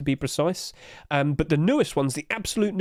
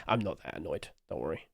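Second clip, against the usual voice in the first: soft reassurance; 11 semitones lower, 11 dB quieter than usual. Pretend. Reassures chat after whisper.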